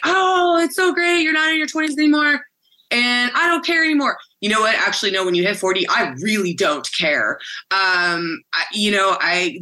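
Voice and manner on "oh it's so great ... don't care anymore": high, bright mock-cheerful voice, much higher; mocking people's cheer. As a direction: parody people gushing about turning thirty. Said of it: "people are like".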